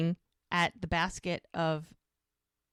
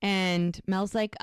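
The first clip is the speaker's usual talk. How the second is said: ordinary talk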